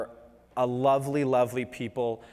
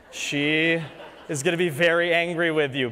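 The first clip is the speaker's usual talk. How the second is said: ordinary talk, nothing marked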